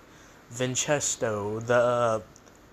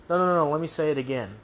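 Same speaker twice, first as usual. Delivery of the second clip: fast, hurried, mildly mock-flustered correction; rushed correction; 2 semitones higher, much faster than usual. Mom quickly corrects herself to the soccer star, fast and flustered. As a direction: rattle it off quickly to fix the mistake. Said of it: "then she's like".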